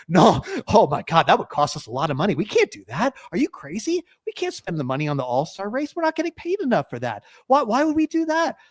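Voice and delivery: imitated penny-pinching teams, pitch climbing high; high-pitched, aghast mock refusal; mock outraged protest. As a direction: balk in exaggerated disbelief. Acts out teams refusing the idea.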